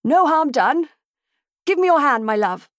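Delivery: a gentleman cheerfully shouting from an overturned carriage; cheerful cry. A narrator's reading. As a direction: cheer everyone up from the wreck. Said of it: "he cried with admirable cheer".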